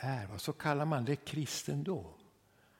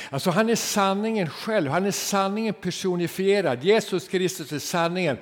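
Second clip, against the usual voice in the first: raised, louder preaching voice; fervent preaching; 6 semitones higher, 6 dB louder than usual. Acted. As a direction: proclaim it from the pulpit. Preacher building to fervour.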